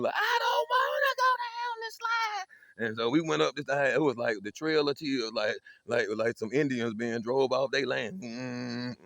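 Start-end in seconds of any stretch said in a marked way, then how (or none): 0.2-2.9 high, slow wailing cry; a scared kid howling his refusal; crying little brother. Retold or imitated. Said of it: "it got louder and louder".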